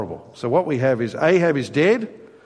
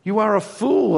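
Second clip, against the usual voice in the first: scornful God; 7 semitones higher than usual. Retold or imitated. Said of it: "God is saying".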